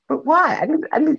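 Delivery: put-on high, pleading little-kid whine; squeaky whining plea; childish whine. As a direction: beg like a child who was just told no.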